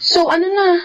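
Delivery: ordinary talk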